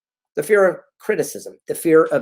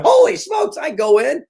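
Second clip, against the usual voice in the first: mock excited viewer; 12 semitones higher than usual. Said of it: "You're watching this right now going".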